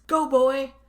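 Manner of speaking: high, loud mock yell; retold yell. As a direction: yell it out as the figure in the mirror. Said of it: "He just, like, yells at him".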